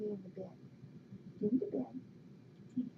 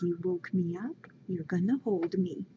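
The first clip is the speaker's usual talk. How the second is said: voicing the cat; 3 semitones lower, 6 dB louder than usual. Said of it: "Snappy says".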